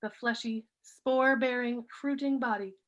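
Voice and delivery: measured poem recital with pauses between phrases; poetry reading. Acted. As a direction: read each line deliberately, letting phrases fall. Reads own poem aloud.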